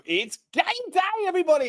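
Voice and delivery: high, bright-pitched hype to the viewers; rally the audience like a matchday host; hyped game day call. Real, not acted. Host opening his stream to fans, high excited hype.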